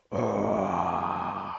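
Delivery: long, low imitated monster roar; distant roar. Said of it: "a distant roar echoing".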